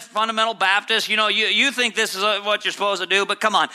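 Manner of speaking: normal talking voice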